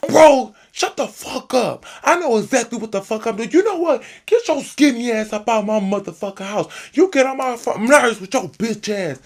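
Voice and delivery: fast high angry rant. Retold or imitated. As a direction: tear into him, fast. Rock furious, kicking him out.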